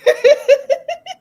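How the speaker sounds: very loud, high laugh; real laughter. Real, not acted. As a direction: burst out laughing mid-story. Cracks up at objection exchange.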